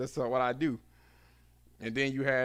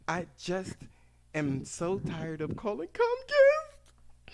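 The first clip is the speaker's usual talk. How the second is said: slow, higher whining into a hammed-up sob; fake-weep the complaint; mock crying Karen; 7 semitones higher, slower than usual. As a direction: Karen whimpering to the cable rep. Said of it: "that's how they say it".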